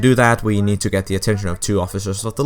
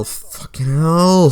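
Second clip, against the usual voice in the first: drawn-out, higher exasperated swearing; exasperated curse; 6 semitones higher, much slower than usual. Real, not acted. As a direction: groan it out slowly in exasperation. Dreading the boat time trial.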